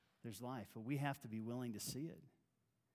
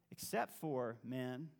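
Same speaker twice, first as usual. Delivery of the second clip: ordinary delivery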